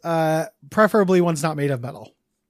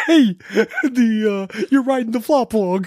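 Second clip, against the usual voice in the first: Vinnie Barbarino; 4 semitones higher, slower than usual. Pretend. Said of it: "turn into Vinnie Barbarino".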